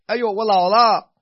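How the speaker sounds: loud, high groaning complaint; hammed-up lament about getting old; mock complaint. Imitating complaining habit.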